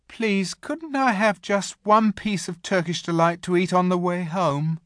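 high-pitched wheedling plea; boyish begging. A narrator's reading. Edmund begs for more sweets.

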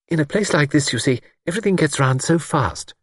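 high, anxious lady's voice; lightly voiced worried spinster. A narrator's reading. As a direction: fret over his rashness. Miss Wainfleet voiced high.

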